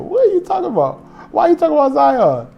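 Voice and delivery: can't believe what he's hearing; incredulous. Real, not acted. Cohost talking Zion gets a high incredulous outburst.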